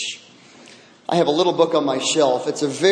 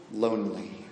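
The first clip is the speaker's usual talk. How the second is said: faded lonely; 9 semitones lower, 11 dB quieter than usual. Retold or imitated. Woman finishing her sad story to elders, fading softly. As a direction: drop to a near-soft breath on lonely. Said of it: "she began sobbing".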